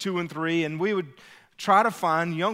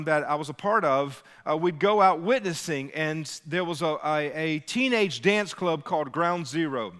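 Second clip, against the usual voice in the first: usual speaking voice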